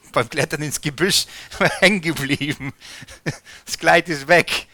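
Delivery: chuckling through a silly guess; laughing joke. Acted. Preacher jokes to the congregation, laughing. Joking guess about lost clothes.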